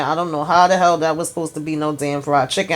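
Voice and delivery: normal talking voice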